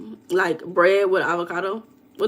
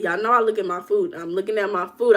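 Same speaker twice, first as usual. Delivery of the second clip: ordinary talk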